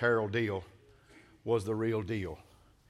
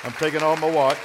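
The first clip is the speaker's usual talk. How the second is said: louder, projected repeat; emphatic announcement; 3 semitones higher, 7 dB louder, faster than usual. Real, not acted. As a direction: call it out over the crowd. Repeats louder over congregation's reaction.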